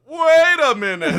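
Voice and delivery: high, very loud yell; Franco shouting. Acted. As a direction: belt out an alarmed interruption. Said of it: "you hear Franco's voice as he goes".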